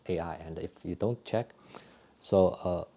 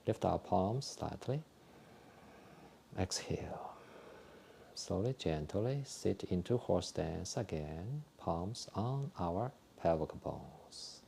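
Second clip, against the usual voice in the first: soft, calm breathing cues with whispered exhales; guided exercise instructions; 7 dB quieter, slower than usual. Acted. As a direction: talk the class gently through each movement. Leads a qigong breathing routine.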